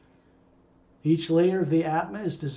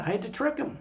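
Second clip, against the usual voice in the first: usual speaking voice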